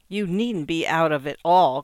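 magnanimous, spoken out loudly; magnanimous cry. A narrator's reading. Secretary makes the wife an offer, magnanimous. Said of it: "cried Miss Rexall magnanimously".

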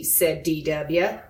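ordinary delivery, nothing marked

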